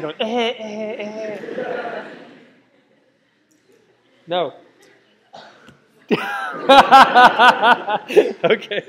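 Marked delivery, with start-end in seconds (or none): 0.2-2.0 short imitated coughs; mock coughing. Lecturer mimicking coughing students, mock coughs. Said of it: "people are just coughing". 6.1-8.4 real burst of loud laughter. Real, not acted. Lecturer laughing at himself before students, loud laugh. Laughing at his own antics.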